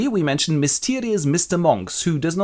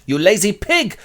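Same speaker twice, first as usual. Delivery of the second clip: high-pitched, forceful insult; scolding wife; 8 semitones higher, 4 dB louder than usual. Acted. Wife berates idle husband, high and scolding. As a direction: berate him in mock outrage.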